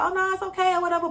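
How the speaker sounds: high fake-breezy it's okay; mock dismissive. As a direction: mimic her brushing it off. Friend fakes not caring.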